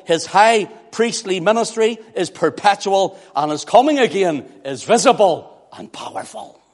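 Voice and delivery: high, loud rhythmic list, each phrase driven home; preaching at full force. Real, not acted. Preacher declaring who the Son is, full-force preaching. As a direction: proclaim each title with mounting force.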